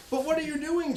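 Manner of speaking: high mock worried wife; husband mimicking his nervous wife; apprehensive wife. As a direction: squeak it out like a fretful wife. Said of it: "she asks apprehensively".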